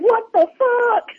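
high, drawn-out mock disbelief; retold shock. Comedian reacting to Will jumping in her car, mock shock. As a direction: imitate a stunned, stretched-out exclamation.

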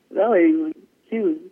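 ordinary talk

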